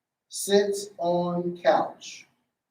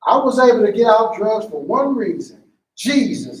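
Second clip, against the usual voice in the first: loud testimony; 4 semitones higher, 6 dB louder than usual. Acted. Acted testimony line.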